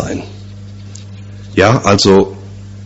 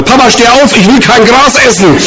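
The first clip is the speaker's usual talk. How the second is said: put-on much higher, loud panicked kid's cry; frantic child shout; 12 semitones higher, 4 dB louder than usual. Boy jumps on father's bed, frantic childish shout. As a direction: yell like a kid shaking his dad awake. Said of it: "wie ein Irrer in dieses Bett gesprungen".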